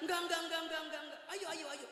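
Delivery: high, frantic no, no, no; husband's high frightened refusal; panicked refusal. Retold or imitated. Refusal repeated.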